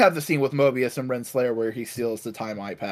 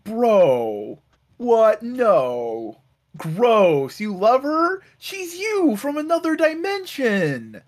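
high, drawn-out disgusted disbelief; hammed-up scolding of a grossed-out friend; mock appalled; 7 semitones higher, slower than usual. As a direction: stretch it out in incredulous, high-pitched mockery. Voicing Mobius appalled and mocking.

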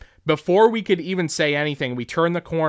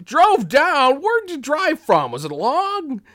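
very high, astonished questioning; hammed-up amazed interest; Tom Atkins impression; 12 semitones higher, 5 dB louder, slower than usual. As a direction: fire back amazed questions, voice way up. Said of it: "inflection is so spot on for him".

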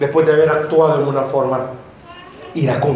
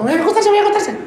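much higher, hurried mock fright; panicky wife; 14 semitones higher, faster than usual. Preacher mimics a wife hearing her angry husband arrive. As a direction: squeak it out like a scared wife rushing to bed.

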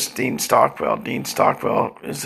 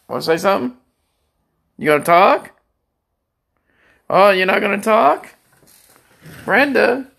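higher, louder mock badgering; teasing taunt; 7 semitones higher, 3 dB louder than usual. Real, not acted. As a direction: needle her until she answers. Taunting silent wife Brenda.

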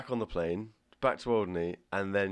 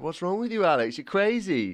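higher, teasing exclamation; playful disbelief; 7 semitones higher, 3 dB louder than usual. Real, not acted. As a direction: tease her loudly for being crazy. Host teasing her big move.

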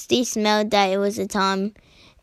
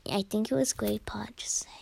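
whispering; 11 dB quieter, faster than usual. Real, not acted. Aside comment while reading.

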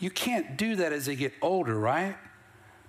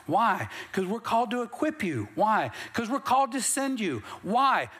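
rousing preaching; 7 semitones higher than usual. Real, not acted. Preacher telling the church why leaders study, rousing preaching. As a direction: drive each answer home to the congregation.

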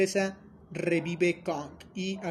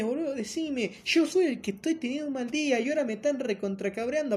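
put-on higher complaining Argentine accent with boludo; mock Argentine accent; 6 semitones higher than usual. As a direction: whine like a dragged-along Argentine buddy. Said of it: "No, no es de Argentina". (acento argentino de burla, quejándose y más agudo)

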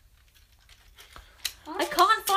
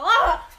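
loud, higher-pitched "ah"; sudden yelp; 4 semitones higher, 9 dB louder than usual. Real, not acted. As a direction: let out a sudden loud yell. Sudden yell while checking time.

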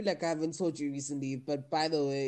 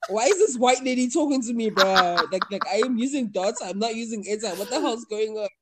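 loud, high retold outburst; acting out a freaked-out caller; mock bewildered panic; 6 semitones higher, 9 dB louder than usual. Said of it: "I'm just like".

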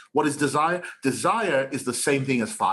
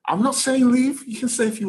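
high defensive; 12 semitones higher, 3 dB louder than usual. Real, not acted. Quickly backtracking, jumps up.

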